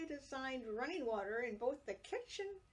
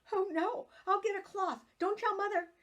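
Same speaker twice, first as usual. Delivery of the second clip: high, loud alarmed cry; panicked child; 9 semitones higher, 8 dB louder than usual. A narrator's reading. Girl frightened after hurting her sister, high loud panic. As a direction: blurt it out in a fright.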